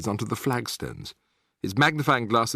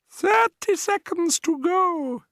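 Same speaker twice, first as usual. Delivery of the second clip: much higher, slow witch cry; 3 dB louder, slower than usual. A narrator's reading. Grand High Witch counting down before the audience, gloating cry. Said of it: "cried the Grand High Witch".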